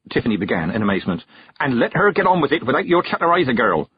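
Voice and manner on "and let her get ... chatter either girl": snapped. A narrator's reading. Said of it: "the witch snapped".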